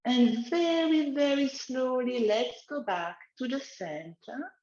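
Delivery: slow, soothing exercise guidance; guided relaxation. Acted. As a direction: lead gently, eyes closed. Leads a guided neck exercise.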